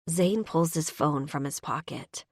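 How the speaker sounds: usual speaking voice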